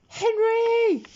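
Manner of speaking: name bellowed very high and loud; Dad yells at Henry over the music; furious yell. A narrator's reading. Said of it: "yelled Dad".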